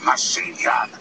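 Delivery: imitated Russian-accented threat. Armed assistant threatening staff, mock Russian accent. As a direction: put on a terse Russian accent. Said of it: "It's more like a Russian accent".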